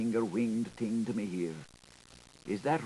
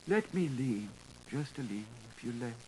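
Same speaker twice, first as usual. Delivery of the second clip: faint murmur; 7 dB quieter than usual. Acted. Faint, leaning on him.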